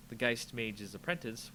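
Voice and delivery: usual speaking voice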